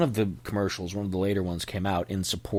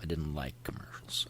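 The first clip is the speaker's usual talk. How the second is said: hushed, unvoiced confession; a quiet whispered admission; 5 semitones lower, 12 dB quieter than usual. Real, not acted. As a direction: whisper it like a secret. Confiding dislike of commercials.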